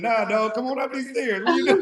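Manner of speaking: high calling voice up the stairs; yelling across the room. Retold or imitated. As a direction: call him upstairs, high and laughing. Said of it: "I'm upstairs at twelve thirty".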